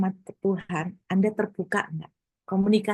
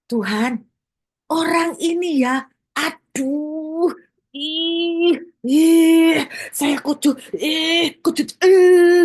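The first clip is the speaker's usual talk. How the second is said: higher and louder, sputtering with frustration at God; angry venting prayer; 7 semitones higher, 7 dB louder, much slower than usual. Retold or imitated. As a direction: vent your anger, half-choking on the words. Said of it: "saya ini kalau doa gini".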